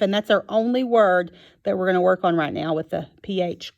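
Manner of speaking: ordinary talk, nothing marked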